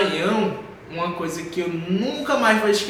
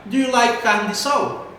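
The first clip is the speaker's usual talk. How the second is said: "Do you like carne de sol" pitched up high; slow, louder "Do you like carne de sol" in a raised tune; high question intonation; 5 semitones higher, 5 dB louder, slower than usual. Shown as a demonstration. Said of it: "a entonação vai lá pra cima".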